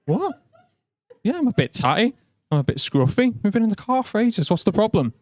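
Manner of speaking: high confused "What?", then defensive; mock-indignant reply; baffled mate. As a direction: play the puzzled English mate under fire. Mate answers the local.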